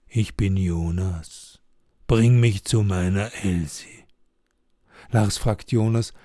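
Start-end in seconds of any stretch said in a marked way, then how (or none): 0.1-4.0 ghost voice. Acted. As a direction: introduce yourself as a sad ghost. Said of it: "Die Stimme sagt".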